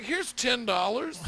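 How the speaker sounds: high, loud, slow flustered offer. Retold or imitated. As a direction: push the money at him, alarmed. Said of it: "I was like".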